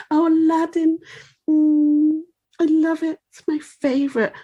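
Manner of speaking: sickly-sweet mocking flirtation; simpering mock seductress. Mocking Jasmine as fawning seductress.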